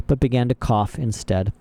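ordinary delivery, nothing marked

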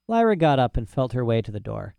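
ordinary delivery

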